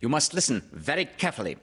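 high, rapid character voice; quick bossy instruction; Rabbit. Acted. Rabbit instructing Kanga, high and fast. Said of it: "said Rabbit".

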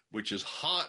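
ordinary delivery

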